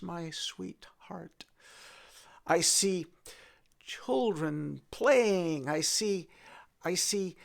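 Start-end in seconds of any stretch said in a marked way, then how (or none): 0.0-1.4 whispered sweetheart; soft unvoiced endearment. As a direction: breathe the words, no voice.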